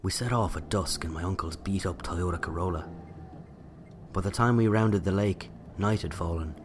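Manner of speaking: usual speaking voice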